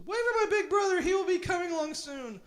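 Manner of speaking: very high, loud goat whine; quoting the tiny goat in a high squeal; squeaky goat voice. Retold or imitated. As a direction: replay the goat's squeak with disdain. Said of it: "What kind of jerk says".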